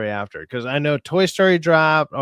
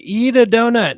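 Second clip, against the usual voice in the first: body's plea; 9 semitones higher, 7 dB louder than usual. Pretend. Voicing body signaling.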